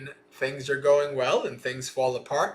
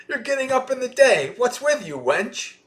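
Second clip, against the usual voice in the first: high-pitched mock scolding; scolding mistress; 10 semitones higher, 4 dB louder than usual. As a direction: tell off the lazy maid in a raised pitch. Acted mistress scolding late maid.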